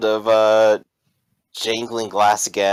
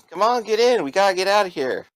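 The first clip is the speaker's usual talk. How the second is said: high, hurried mock yell; acted yell; 7 semitones higher than usual. Robber rushes his driver to leave, mild urgent yell. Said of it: "Mont yelling at him".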